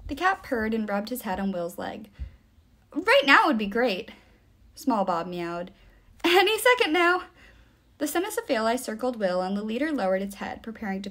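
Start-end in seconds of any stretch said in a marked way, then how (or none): 2.9-4.1 louder, higher impatient plea; urgent prompting. Acted. Hero urgently prompts the cat. 6.2-7.2 higher, louder, pressing hint; urgent prompting. Acted. Hero urgently prompts the cat.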